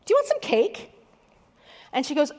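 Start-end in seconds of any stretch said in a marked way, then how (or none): 0.0-0.9 much higher, bright hostess offer; cheerful offer. Retold or imitated. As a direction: offer the cake sweetly and high. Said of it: "and I said".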